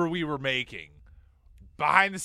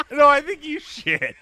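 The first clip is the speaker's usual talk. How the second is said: shouting through laughter; laughing shout; 13 semitones higher, 12 dB louder than usual. Real, not acted. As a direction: yell it while cracking up.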